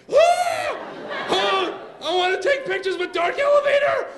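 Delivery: put-on high, shrieking excited children; hammed-up squealing frenzy; kids leaping up. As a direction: scream it like overjoyed kids. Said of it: "both of my kids, like, leap up".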